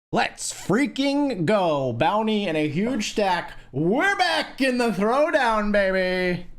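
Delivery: real triumphant shout; excited cheer. Real, not acted. Celebrating a big poker win.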